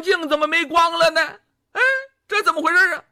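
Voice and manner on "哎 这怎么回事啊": plain reading voice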